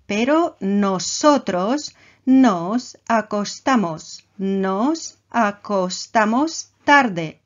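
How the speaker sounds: slow and deliberate, leaning on nosotros; slow, stressed model phrase. Shown as a demonstration. Teacher repeating the model sentence slowly. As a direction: spell it out slowly for the learners. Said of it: "De nuevo el verbo acostarse".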